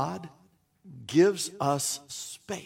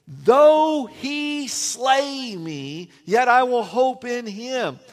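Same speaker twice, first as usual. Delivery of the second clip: very slow, higher and louder, every word weighed; solemn vow; 6 semitones higher, 8 dB louder, much slower than usual. Retold or imitated. Preacher reciting Job's vow to God, slow and solemn. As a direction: lean hard into a vow of trust.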